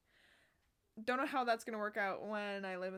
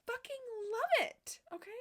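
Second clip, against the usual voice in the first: intense excitement; 11 semitones higher, 5 dB louder than usual. Real, not acted. Vlogger gushing to viewers about dream boots, intense excitement. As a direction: stretch it out with fierce, high-pitched passion. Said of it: "sorry, I get intense".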